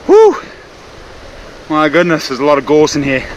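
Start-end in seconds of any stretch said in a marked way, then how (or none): 0.1-0.4 loud relieved whew. Real, not acted. Relief pushing through gorse.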